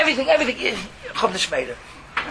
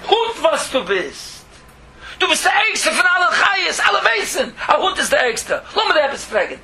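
roaring lion; 7 semitones higher than usual. Acted. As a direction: roar down from the throne. Lion roars at dog.

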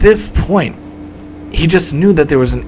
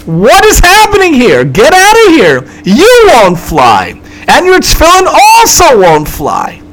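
loud, high angry shouting; acted furious tirade at a passenger; irate pilot; 10 semitones higher, 6 dB louder than usual. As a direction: yell like a pilot throwing someone off the plane. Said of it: "the pilot comes over to him and says".

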